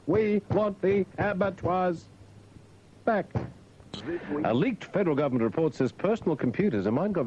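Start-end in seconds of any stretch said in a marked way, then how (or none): 0.1-3.3 slow, dragged-out repeat of the demand; mock protesters dragging the line out slowly; drawn out. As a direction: stretch every word of the chant out.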